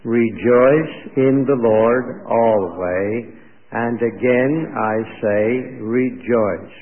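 slow, drawn-out recitation in even phrases; group recitation. Shown as a demonstration. Preacher leads the congregation reciting the verse, slow recitation. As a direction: recite it slowly so others can join. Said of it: "recite it with me".

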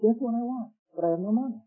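put-on kid's wistful thought. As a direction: think it aloud in a small high voice. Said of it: "And he thought".

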